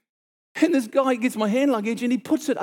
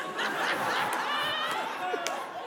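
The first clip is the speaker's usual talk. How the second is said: a high, soft burst of laughter; real laughter, much higher and softer; 10 dB quieter than usual. Real, not acted. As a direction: laugh at your own memory. Laughing at his own story.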